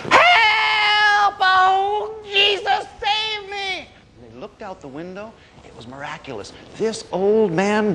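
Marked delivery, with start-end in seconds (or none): 0.1-3.9 very high, drawn-out panicked wail; god-awful scream. Acted. Old man fleeing a bear, high god-awful scream. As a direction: scream for rescue at the top of your range. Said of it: "they heard this god-awful scream".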